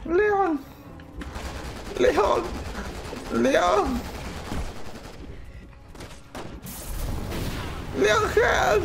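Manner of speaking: high, plaintive calls for rescue; insistent high-pitched calling; calling for help. Real, not acted. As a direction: cry out the name in a high pitch. Calling game partner for help.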